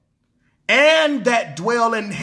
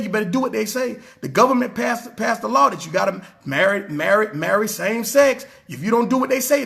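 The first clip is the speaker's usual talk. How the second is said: ordinary talk, nothing marked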